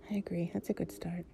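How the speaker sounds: soft whisper. Acted. Lying close to a partner, drifting off, in a soft whisper.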